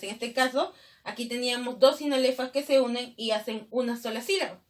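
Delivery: normal talking voice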